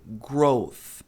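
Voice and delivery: "growth" with final tongue-between-teeth TH; voiceless TH. Shown as a demonstration. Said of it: "Esse é o som do T H".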